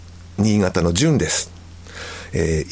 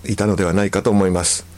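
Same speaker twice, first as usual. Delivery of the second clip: ordinary talk, nothing marked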